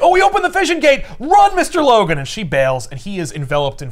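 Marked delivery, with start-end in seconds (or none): 0.0-2.2 high, fast, loud alarm; hammed-up screech to flee; frantic high cry of warning; panicked shout. Hines panicking, fleeing fire.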